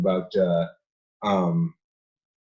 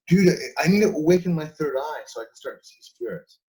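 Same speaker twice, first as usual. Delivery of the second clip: eager seeker; 6 semitones higher than usual. Retold or imitated. Mocking a spiritual seeker who wants to see spirits, a bit higher. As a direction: play the wide-eyed spiritual seeker. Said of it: "they're like".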